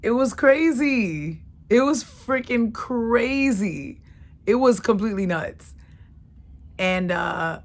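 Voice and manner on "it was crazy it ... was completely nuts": exclaiming. Real, not acted. Exclaims crazy.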